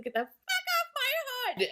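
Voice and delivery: high-pitched acted shriek from a movie; hammed-up high holler; mock yell.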